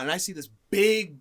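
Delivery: normal talking voice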